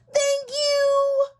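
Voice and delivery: high, loud grateful cry; excited. Acted. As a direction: squeal your thanks. Frog thanks Mister Herman.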